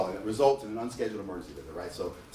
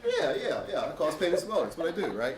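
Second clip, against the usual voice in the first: wry sarcasm; 5 semitones higher than usual. Retold or imitated. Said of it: "I'm like".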